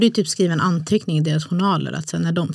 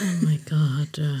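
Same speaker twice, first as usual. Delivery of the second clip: slow, softer breathy whisper; shocked whisper; 3 semitones lower, 4 dB quieter, much slower than usual. Real, not acted. Friend reacting to the story, whispering in shock. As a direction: breathe it out in disbelief.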